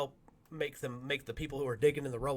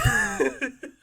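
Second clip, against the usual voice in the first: very high squealing laugh; 8 dB louder than usual. Real, not acted. Narrator cracking up at the firefighters' shock, high squeal. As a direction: laugh hard.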